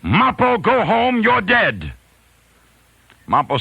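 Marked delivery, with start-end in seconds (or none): 0.0-1.9 high, loud yell across the hall. Retold or imitated. Friend on stage yelling at Mappo, loud high shout. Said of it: "He yelled".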